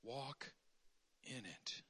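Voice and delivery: soft, slow, low voice; gentle guiding voice. Retold or imitated. The voice behind you guiding the way, soft and slow. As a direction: guide them quietly from behind. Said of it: "a voice behind you saying".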